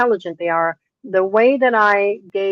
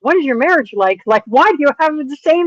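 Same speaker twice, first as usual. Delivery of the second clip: raised pitch, emphatic disbelief; retold exasperation; 7 semitones higher, 4 dB louder than usual. Trainer questioning friend's repeat horse choice, exasperated. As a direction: press the question, mock-incredulous.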